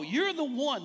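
"one" said emphatically, as if capitalised; stress on ONE. Shown as a demonstration. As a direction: lean hard into "one". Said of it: "capital O".

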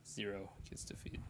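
breathy whispered punchline. Shown as a demonstration. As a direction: drop to a whisper. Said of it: "I love when I said zero, I did this".

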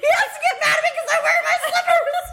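high squealing voice, nearly losing it; hysterical laughing. Real, not acted. Friend explains the real reason for yelling, hysterical laughing. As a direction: shriek it out through real laughter. Storyteller hysterical over own joke.

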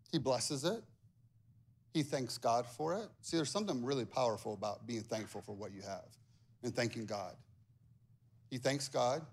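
ordinary delivery

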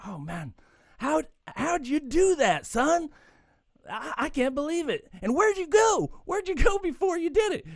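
high, put-on astonished Texan voice; amazed Texan. Texan at pub bar amazed by the drinker, high astonished voice. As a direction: gasp it out like a stunned cowboy. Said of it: "the Texan's just amazed".

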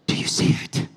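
breathy, hushed, reverent question; whispered awe. Retold or imitated. Art connoisseur confides to a stranger, hushed whisper. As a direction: lean in and whisper it like a secret.

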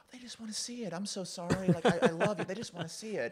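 very high mock-pleading husband; high, whiny-sorry plea in caricature; pleading apology. Imitated husband pleading with wife.

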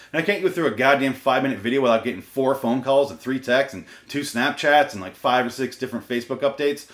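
ordinary talk, nothing marked

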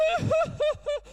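high, pulsing pretend wail; mock sobbing. Father acts out his daughter in distress, mock sobbing. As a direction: imitate a child crying in high bursts. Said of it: "And she's, she's".

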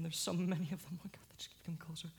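fast frightened whisper; terrified whisper. Acted. Trapped man whispering fast in terror at the coming army. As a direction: panic under the breath.

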